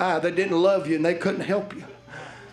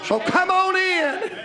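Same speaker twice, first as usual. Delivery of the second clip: preaching invitation; 8 semitones higher, slower than usual. Acted. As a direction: call them in from the pulpit. Preaching at full force.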